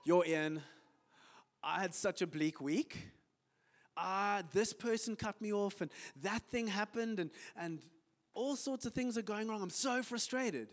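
mock grumbling colleague; complaining friend. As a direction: pour out a bad week. Acted exchange, moaning Jethro.

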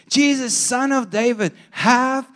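high, drawn-out calling; mock yell, slowing on the plea. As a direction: imitate an insistent cry for mercy. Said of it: "and he yelled louder".

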